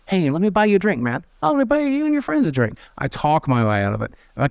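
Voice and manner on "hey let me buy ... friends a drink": higher, eager friendly placating voice; eager appeasing. Retold or imitated. To an angry guy at a bar, high eager placating voice. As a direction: sweet-talk an angry stranger. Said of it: "they don't like the look of me".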